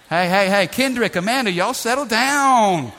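loud, high call to settle down; mock scolding. Preacher jokingly breaking up a whispering couple, mock scolding. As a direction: playfully holler at a rowdy couple, drawing out the end.

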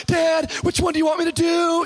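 high, loud comic wail; mock exasperation. Son asking dad which order to follow, exasperated cry. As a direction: protest like a baffled kid.

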